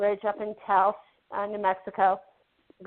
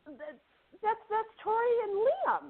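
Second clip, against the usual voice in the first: retold delighted recognition; 12 semitones higher than usual. Actor meeting her two kids, delighted. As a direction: imitate happy surprise in a high voice.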